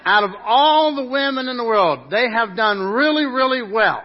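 preacher teasing his wife Rhonda, loud and laughing; joking to wife. Pretend. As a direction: play it big and teasing for your wife. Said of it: "I get to tell my wife".

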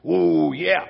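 loud, high gleeful ooh; proud whoop. Real, not acted. Man bragging about his new engineer title, loud proud whoop. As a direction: crow about the promotion.

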